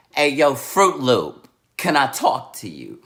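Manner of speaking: loud, punchy call-out; confrontational mother. Retold or imitated. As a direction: call the teacher out, imitating an angry mom. Mother confronting teacher in classroom.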